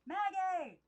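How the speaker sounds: high, loud call from the garden. Acted. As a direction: call her name across the yard. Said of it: "Aunt Lillian called for some, from somewhere below".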